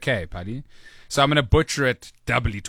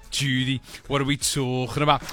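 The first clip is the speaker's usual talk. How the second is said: lightly put-on Liverpudlian accent; a hint of Liverpool chat. Host usually asks Judy this, mock Liverpudlian accent. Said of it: "I do a Liverpudlian accent in this moment".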